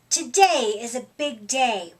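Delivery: ordinary delivery, nothing marked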